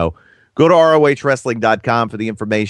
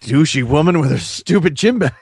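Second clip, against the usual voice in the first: higher-pitched, put-on indignant grumble; mock John O'Hurley; 5 semitones higher than usual. Fallen TV star cursing the woman's gym bag, mock-indignant. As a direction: play the indignant star cursing after his fall. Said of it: "I wonder what he said".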